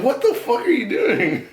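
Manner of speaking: very high, loud, laughing outburst; shocked protest. Retold or imitated. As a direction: squeal the objection through laughter. Retells his shocked protest to girl.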